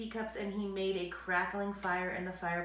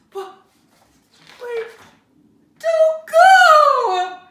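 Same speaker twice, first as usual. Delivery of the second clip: much higher, much louder wailing shout; 21 dB louder, much slower than usual. Acted. Bear begs mouse to stay, loud wail. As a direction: wail desperately after him. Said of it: "wailed Bear".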